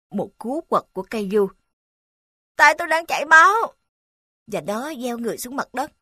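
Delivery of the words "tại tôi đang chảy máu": pained cry. Acted. Boy bleeding, calls out.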